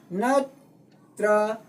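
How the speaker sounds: ꯅꯠꯇ꯭ꯔꯒ slowly split into three separate syllables. Shown as a demonstration. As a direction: stretch ꯅꯠꯇ꯭ꯔꯒ into three beats with pauses between. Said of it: "syllable ꯑꯃꯅꯤ". (ꯅꯠꯇ꯭ꯔꯒ ꯈꯣꯟꯊꯣꯛ ꯈꯨꯗꯤꯡ ꯇꯣꯉꯥꯟꯅ ꯇꯞꯅ ꯍꯥꯏꯕ)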